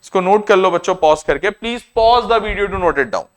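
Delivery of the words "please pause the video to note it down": slow request. Real, not acted. Asks viewers to pause.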